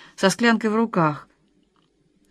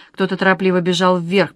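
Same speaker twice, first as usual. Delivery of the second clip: plain reading voice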